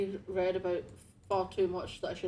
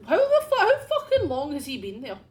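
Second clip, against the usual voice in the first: startled shout; 8 semitones higher, 10 dB louder than usual. Real, not acted. Startled by a corpse.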